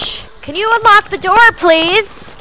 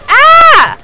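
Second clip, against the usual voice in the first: frustrated yell; 9 semitones higher, 6 dB louder than usual. Real, not acted. Frustrated outburst.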